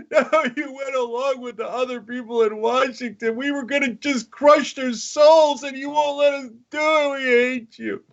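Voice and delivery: high, crying, whining betrayed fans; mock whiny maga fans. Mocking maga fans wailing at Trump, high and weepy. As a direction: wail it like heartbroken followers. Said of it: "They're like".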